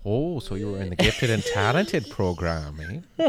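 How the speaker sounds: slow, drawn-out Minnesota "eh"; mock Minnesota accent. Host teasing guest about gifted program, mock Minnesota accent. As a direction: tease him in a thick Minnesota accent. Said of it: "you doing that accent".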